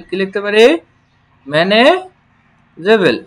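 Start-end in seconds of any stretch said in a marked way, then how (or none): 1.5-3.2 manageable stretched very slowly, every syllable clear; walk learners through each sound; slow teacher demo; slow model word. Shown as a demonstration. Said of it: "teacher models the word".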